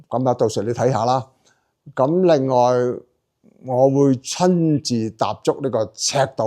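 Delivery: ordinary talk, nothing marked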